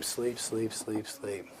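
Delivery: hushed, low, slow near-whisper; very soft, soothing hypnotist voice; hypnotic induction. Real, not acted. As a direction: lull them into deep sleep with long pauses.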